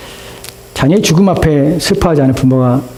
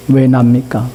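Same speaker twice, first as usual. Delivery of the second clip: usual speaking voice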